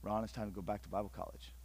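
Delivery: soft, low, tender call; gentle voice of God. Acted. God calling Ron back, soft and tender. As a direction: speak like a quiet, loving summons.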